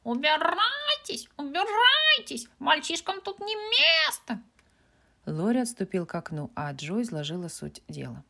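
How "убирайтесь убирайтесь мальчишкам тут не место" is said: high, loud, slow squawking bird; screeching parrot. A narrator's reading. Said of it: "выкрикнул".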